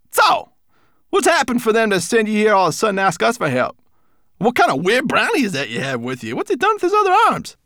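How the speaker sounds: very high, fast, loud taunting; scornful brownie. A narrator's reading. Strange brownie mocks the dragon, high and fast. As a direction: sneer at them in a squeaky rush.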